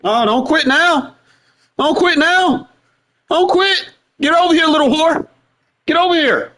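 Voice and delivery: high, mocking taunts at the opponent; taunting. Real, not acted. Trash-talking the opponent across the match, high mocking taunt. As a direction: goad him like a schoolyard bully, pitched high.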